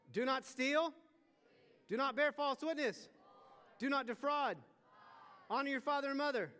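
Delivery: loud, high call to the congregation; preaching at full force. Real, not acted. Preacher rallying the crowd to count along. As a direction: rouse the room as you count.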